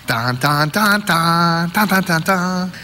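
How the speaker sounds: ominous sung "dun dun dun"; imitated film music. The music comes on at the horror, mock-ominous chant. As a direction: voice a suspense soundtrack, rising in pitch. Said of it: "the music comes on".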